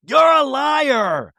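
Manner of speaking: high, slow shouted accusation; hammed-up finger-pointing yell; mock accusation. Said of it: "of like".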